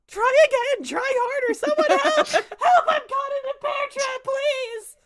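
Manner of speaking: very high, loud screaming for help; panicked shout. Acted. The trapped knight screams at the party to free him. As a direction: yell in a high panic, stuck in a trap.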